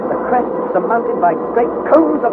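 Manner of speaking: high, intense storytelling; dramatic narration. A narrator's reading. Narrator describing lightning over the raft, awed intensity. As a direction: tell it at the storm's height, voice lifted.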